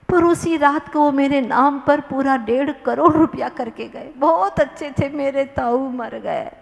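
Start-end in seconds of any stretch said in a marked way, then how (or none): none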